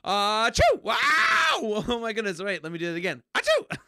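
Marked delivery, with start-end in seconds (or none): 0.0-0.8 fake sneeze. 1.0-1.5 loud high laugh; high burst of laughter. Real, not acted. Laughs after sneeze. 3.3-3.8 high loud theatrical sneeze; fake sneeze.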